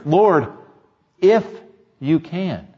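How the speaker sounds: "if" cut off between two long pauses; pause, single out "if", pause, then go on; stress on IF. Acted. Doubter asking Jesus for help, isolated "if". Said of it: "There's no ifs".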